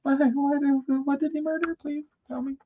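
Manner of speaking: very high squeaky begging; wife hamming up horror at her son's text, high squeaky plea; mock horrified plea. Hammed-up begging.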